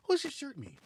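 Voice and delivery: sudden high questioning pitch; curious stranger. Retold or imitated. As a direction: ask it innocently, pitch jumping up. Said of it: "He goes".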